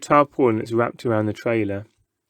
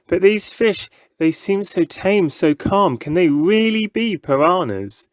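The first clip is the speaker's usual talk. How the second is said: put-on wondering boy; 7 semitones higher than usual. A narrator's reading.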